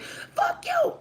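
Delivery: sudden shrill high retort; mock outburst at the haters; snapping back. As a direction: fling it back at them.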